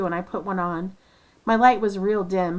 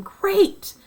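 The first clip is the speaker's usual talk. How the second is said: much higher, loud cheer; trapped miners cheering the good news, high and loud; 12 semitones higher, 5 dB louder than usual. Retold or imitated. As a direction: yell it out in relief. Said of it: "they were yelling".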